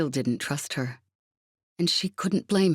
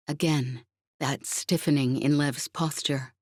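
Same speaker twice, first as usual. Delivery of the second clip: ordinary delivery, nothing marked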